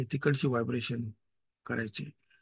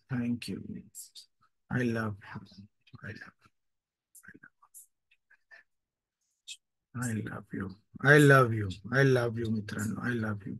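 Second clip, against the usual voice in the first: lower, softer thankful murmur; soft affirmations; 3 semitones lower, 3 dB quieter, much slower than usual. Acted. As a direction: whisper gratitude gently. Closing meditation affirmations.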